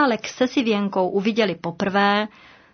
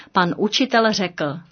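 ordinary delivery

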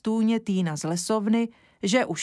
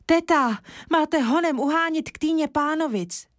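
urgent call; 8 semitones higher than usual. A narrator's reading. Kája calls urgently to aunt.

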